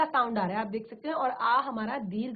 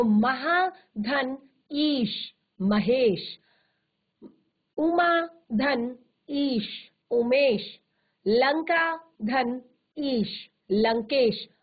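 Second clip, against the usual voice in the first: slow deliberate recitation; much slower than usual. Shown as a demonstration. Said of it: "teacher recites examples slowly, deliberately".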